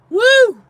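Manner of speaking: high, loud woo of relief; joyful whoop. Real, not acted. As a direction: let out a big happy whoop. Relief of letting go.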